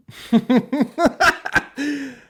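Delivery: high burst of laughter; loud laugh. Real, not acted. Host bursts out laughing at a listener's made-up story. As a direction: crack up loudly.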